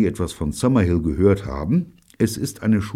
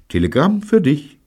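tag; 11 semitones higher, 7 dB louder than usual. A narrator's reading. Jean weckt Neil, überbringt Telegramm.

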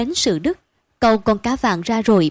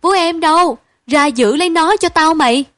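loud, higher call across the house; calling out loudly; 7 semitones higher, 7 dB louder than usual. A narrator's reading. Mother shouting for the nanny to hold the boy. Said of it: "mợ gọi ồn nhà".

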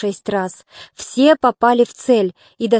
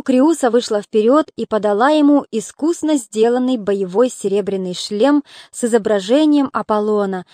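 ordinary delivery

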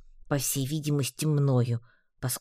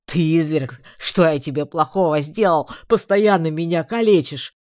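outraged wail; 3 semitones higher, 8 dB louder than usual. A narrator's reading. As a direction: rail at her for the beatings. Beaten orc accuses heroine.

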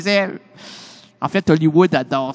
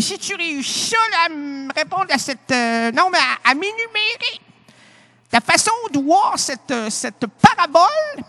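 philosophy teacher; 9 semitones higher than usual. Retold or imitated. Philosophy teacher quizzing his student, high and fussy. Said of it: "Mon professeur de philosophie m'a dit".

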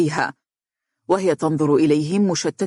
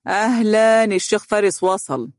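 sarcastic; 5 semitones higher than usual. Acted. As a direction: announce him with exaggerated scornful welcome. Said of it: "ابتسم حسن بسخرية". (بسخرية وترحيب مصطنع)

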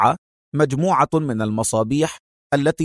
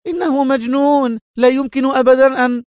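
panicked; 12 semitones higher than usual. A narrator's reading. Nurse rushes to the room, high and panicked. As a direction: blurt out in fright. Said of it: "مستطردة في ذعر".

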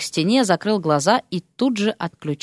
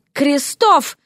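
commanding call; 6 semitones higher than usual. A narrator's reading. Fiancée calls him again.

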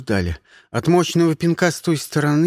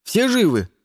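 higher-pitched shouted question; calling out; 6 semitones higher, 3 dB louder than usual. A narrator's reading. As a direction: call out to check on the others. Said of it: "крикнул я". (окликает, повысив голос)

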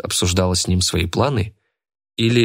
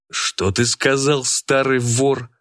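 slow, higher-pitched indignant exclamation; exclaiming; 5 semitones higher, slower than usual. A narrator's reading. Tretyakov challenges the old man, exclaiming. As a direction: draw the challenge out slowly, pitched up. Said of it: "воскликнул он".

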